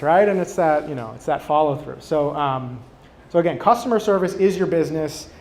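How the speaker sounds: ordinary delivery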